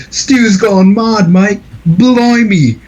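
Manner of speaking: high, drawn-out mock British accent; hammed-up British panic; mock British Randy. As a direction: exaggerate a British cry of alarm. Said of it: "Randy's like".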